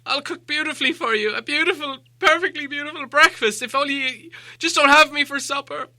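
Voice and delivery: high, stammering hobbit begging; frightened pleading. Acted. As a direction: beg the trolls not to cook him.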